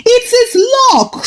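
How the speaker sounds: high, different voice for the villagers; villagers' voice. Acted. Villagers quoted.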